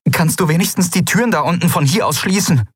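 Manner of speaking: high, exasperated question; urgent demand. Acted. Endriel presses Aylan to close the doors, exasperated. Pressing the pilot under attack.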